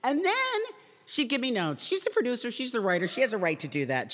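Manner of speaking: ordinary talk, nothing marked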